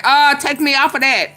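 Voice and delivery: playful no-thanks; dismissive refusal. Retold or imitated. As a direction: wave off the family group chat. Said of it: "I be like".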